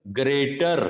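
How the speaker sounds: British-style ग्रेटर, the r left silent; non-rhotic greater. Shown as a demonstration. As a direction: say ग्रेटर dropping the last r. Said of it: "ग्रेटर में पीछे का आर नहीं बोला जाएगा".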